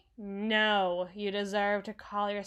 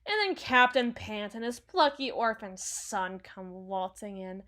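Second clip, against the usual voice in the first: ordinary talk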